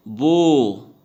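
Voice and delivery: बो with a loud, long "oh"; stretched vowel. Shown as a demonstration. Teacher showing w becomes a vowel, बो with long ओ. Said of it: "ओ आ जा रहा है".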